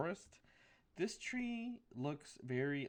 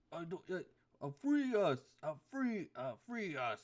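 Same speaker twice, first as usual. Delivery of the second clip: goat voice; 5 semitones higher, 5 dB louder than usual. Acted.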